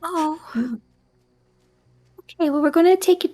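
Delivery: put-on reassuring child. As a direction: coax her kindly to go downstairs. Reassuring child about bar lady.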